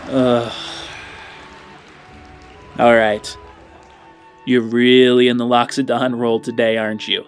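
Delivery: normal talking voice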